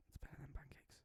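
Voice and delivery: unvoiced whisper to oneself; hushed whispered read. Real, not acted. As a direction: whisper it very softly, barely any voice. Whispers line before reading it aloud.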